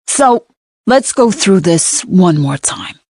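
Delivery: a light New York cop drawl, slowed down; a detective leaning on his New York vowels, unhurried. A narrator's reading. Cop questioning the narrator on the sofa, light New York drawl. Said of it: "the cop says in his New York drawl".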